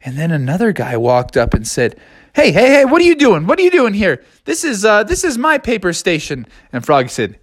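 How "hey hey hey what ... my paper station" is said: indignant guy. Acted. Rival paper guy confronting Froggy, high indignant voice. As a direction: call out the intruder, high and sharp.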